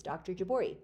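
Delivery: usual speaking voice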